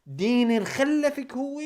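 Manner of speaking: high, loud, slow furious curse; angry outburst. Real, not acted. Vlogger cursing at the guest, slow furious outburst. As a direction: spit it out in outrage.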